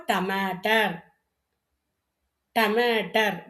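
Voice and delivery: "டமேட்டர்" ending on a sounded r; final bare r. Shown as a demonstration. Teacher showing the Hindi word for tomato, "டமேட்டர்" with final r. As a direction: say "டமேட்டர்" closing on r with no vowel after. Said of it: "இங்க இர்ன்னு சொல்லணும்".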